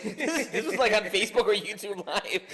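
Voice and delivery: high, laughing panicked voice; frantic high warning through laughs; laughing Kevin Hart. Retold or imitated.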